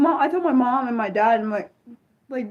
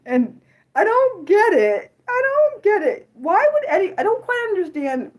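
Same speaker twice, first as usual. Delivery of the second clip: exasperated; 10 semitones higher, 4 dB louder than usual. Real, not acted. Exasperated over scary movies.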